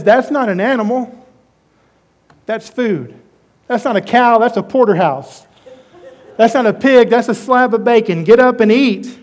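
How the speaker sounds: a lively, half-joking voice of God; eager divine urging. Acted. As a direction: sell the food like a grinning host. Preacher voices God urging Peter.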